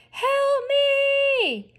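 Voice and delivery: shouted plea. A narrator's reading. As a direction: yell it out over the chaos. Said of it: "And Otis shouted".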